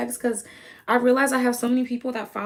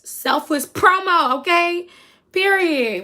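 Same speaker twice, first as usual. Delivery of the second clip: hype; 8 semitones higher, 7 dB louder, slower than usual. Real, not acted. Hyping a friend's product.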